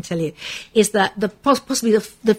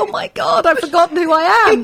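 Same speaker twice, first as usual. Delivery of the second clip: high, loud mock horror through amusement; comic, high-pitched exclamation of dismay; retold panic; 8 semitones higher, 6 dB louder than usual. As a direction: act out suddenly realising a blunder. Retells panic over wrong show, amid laughter.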